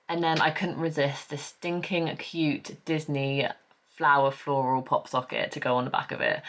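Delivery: ordinary talk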